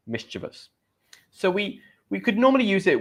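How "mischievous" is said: "Mischievous" as MIS-chuh-vus, quietly repeated; correct pronunciation. Shown as a demonstration. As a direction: say "Mischievous" softly with a short middle "chuh". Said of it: "Not mischievous".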